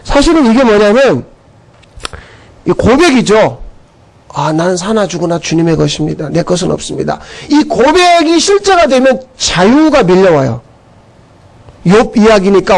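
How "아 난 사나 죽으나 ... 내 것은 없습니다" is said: soft confession. Acted. Quoted confession of faith.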